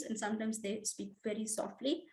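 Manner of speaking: softly spoken, hushed. Shown as a demonstration. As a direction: let it fall to a hush.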